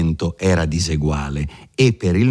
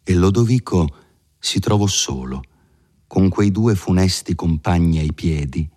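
usual speaking voice

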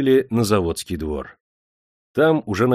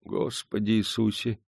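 hushed, wary invocation; superstitious dread; 6 dB quieter than usual. A narrator's reading. Old worker eyes Prokofy superstitiously, hushed and wary. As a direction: murmur a protective oath. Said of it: "суеверно оглядел его работный".

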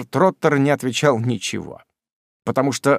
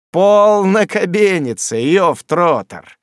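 mocking rebuke; 5 semitones higher, 5 dB louder than usual. A narrator's reading. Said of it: "сказал Самуэль".

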